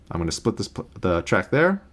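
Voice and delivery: ordinary talk, nothing marked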